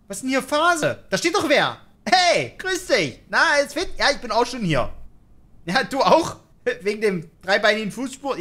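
high, put-on chatty hello; chummy greeting. Player greets lurking figures as fellow visitors, chummy greeting. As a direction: hail them like old pals, pitched well up.

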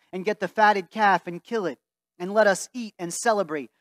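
ordinary delivery

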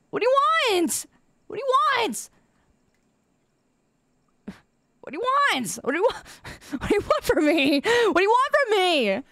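high, whiny plea; mock desperation. Streamer pleading with pestering chat, mock desperation. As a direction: wail it in playful despair.

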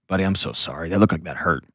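low, tender acted reassurance; attending parent. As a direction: gently console a hurt kid. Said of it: "Attending is".

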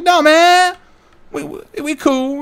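squeaky chill reassurance; high squeaky dude voice. Retold or imitated. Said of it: "And like".

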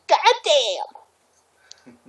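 ordinary talk

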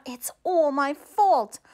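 rising, tearful self-blame; mildly sobbing out the sad news. Acted. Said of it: "sobbed Josephina".

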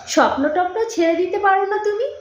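high-pitched, climbing question; exasperated plea. A narrator's reading. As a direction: urge him to give it up, voice lifting. Girl pleads against his dreams.

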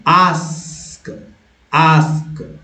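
"आस्क" drawn out with American a; American accent. Shown as a demonstration. Teacher repeating the contrast for learners, American accent. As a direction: say "आस्क" stretched, American style. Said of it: "American accent में आस्क".

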